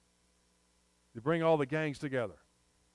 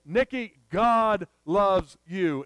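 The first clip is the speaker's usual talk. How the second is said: slow and louder, "Nikki" called high; persistent, emphatic second telling; louder declaration; 3 semitones higher, 9 dB louder, much slower than usual. Retold or imitated. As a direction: press it on him harder this time.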